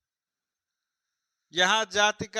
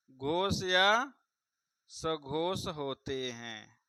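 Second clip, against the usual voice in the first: very drawn-out words, sinking lower and softer; slow dictation; 4 semitones lower, 5 dB quieter, much slower than usual. Shown as a demonstration. Teacher repeats the rule for students, slow dictation. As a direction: spell out the rule slowly, letting it drop away.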